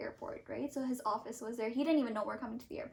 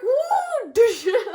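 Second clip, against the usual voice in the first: high, loud, laughing whoop; whooping through laughter over the wagon; laughing woo; 10 semitones higher, 10 dB louder than usual. Real, not acted. Wagon swinging round.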